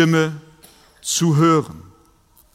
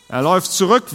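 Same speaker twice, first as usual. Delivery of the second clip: normal talking voice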